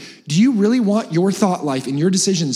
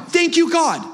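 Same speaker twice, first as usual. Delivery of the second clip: mock gratitude; 11 semitones higher, slower than usual. Mock grateful after a car breakdown.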